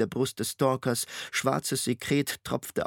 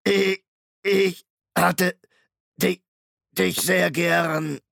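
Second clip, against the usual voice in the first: laut, langsam, höher, leiernd wie ein kaputtes Tonband; leiernde Maschinenstimme; 6 semitones higher, 6 dB louder, much slower than usual. A narrator's reading. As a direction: zieh den Namen wie ein defektes Band. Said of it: "Die Stimme leierte und knackte wie ein beschädigtes Tonband". (Shrike spricht Hester an, leiernd und knackend wie ein Tonband)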